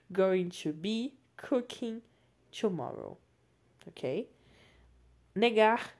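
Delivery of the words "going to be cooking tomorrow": slow model sentence. Shown as a demonstration. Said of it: "mesmo significado".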